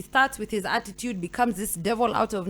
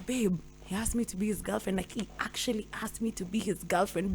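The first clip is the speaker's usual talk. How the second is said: softer, giddy confiding; hushed excited gossip; gushing to friends; 4 semitones lower, 8 dB quieter than usual. Retold or imitated. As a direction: spill the secret to your girls. Telling girlfriends the news.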